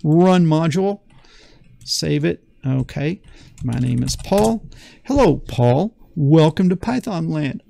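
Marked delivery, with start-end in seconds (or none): none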